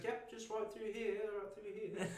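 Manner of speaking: high, put-on British voice; British accent. British guy showing people round the bus, high put-on British accent. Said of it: "I hear this British guy".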